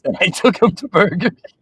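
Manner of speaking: high, loud, laughing delivery; words squeaking out through real laughter; bursting into laughter. Real, not acted. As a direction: crack up halfway through your own joke. Cracks up telling own joke.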